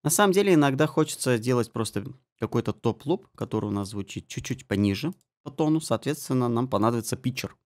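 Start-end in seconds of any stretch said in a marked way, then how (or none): none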